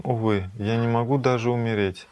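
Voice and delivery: normal talking voice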